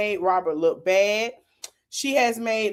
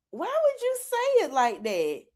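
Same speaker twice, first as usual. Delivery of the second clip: high-pitched mock bafflement; mock disbelief; 8 semitones higher, 4 dB quieter than usual. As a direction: act puzzled, pitched well up. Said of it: "we just look at each other".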